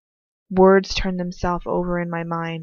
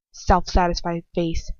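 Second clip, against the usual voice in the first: ordinary delivery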